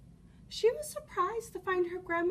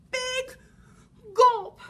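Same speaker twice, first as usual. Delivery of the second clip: ordinary delivery, nothing marked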